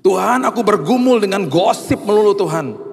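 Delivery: high, emphatic cry to God; pleading prayer. Acted. Believer confessing struggle to God, high pleading prayer.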